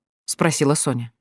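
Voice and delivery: plain reading voice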